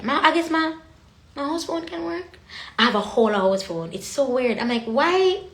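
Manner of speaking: ordinary talk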